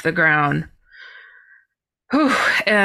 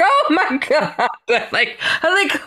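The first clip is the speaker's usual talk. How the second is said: laughing; 8 semitones higher than usual. Retold or imitated. Retold shock, laughing.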